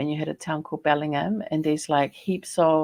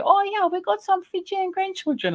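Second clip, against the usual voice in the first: much higher, altered voice for the wife; imitating the wife. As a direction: voice the wife's cheerful reply up high. Retold dinner conversation with wife.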